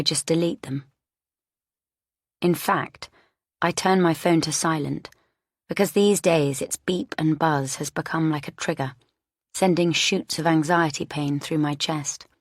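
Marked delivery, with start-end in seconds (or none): none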